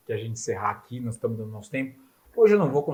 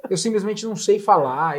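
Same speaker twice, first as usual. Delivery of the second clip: retold pleading; 8 semitones higher, 6 dB louder than usual.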